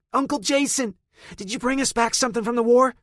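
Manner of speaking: put-on high, fast excited kids' voices; kids screaming. A narrator's reading. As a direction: squeal it like thrilled children. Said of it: "them screaming".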